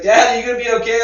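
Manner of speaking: loud, high imitation of a daughter calling out; mimicked worried kid's yell; child yelling. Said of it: "yelling out to me".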